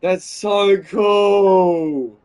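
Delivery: loud, slow, stretched-out gushing; awed delight. Real, not acted. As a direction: draw it out, completely thrilled. Player thrilled by ending.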